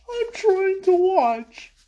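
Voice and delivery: high, slow, annoyed mock Sokka; Sokka, annoyed at the interruption, high and slow; acting Sokka. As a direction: shush them and keep watching, high-pitched. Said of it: "And then Sokka".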